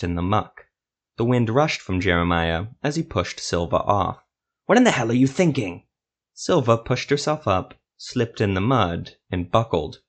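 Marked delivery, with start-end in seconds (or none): 4.6-5.8 angry. A narrator's reading. Jeremiah yells after Silva's fall.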